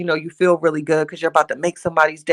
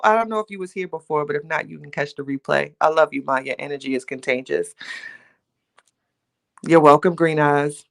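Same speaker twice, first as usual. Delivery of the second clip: ordinary talk, nothing marked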